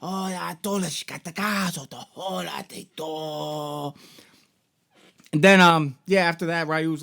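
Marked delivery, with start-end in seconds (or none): 0.0-3.9 hushed fake Japanese last words; mock Japanese. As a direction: fake Japanese gibberish, quiet and slow. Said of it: "his dad says something along the lines like".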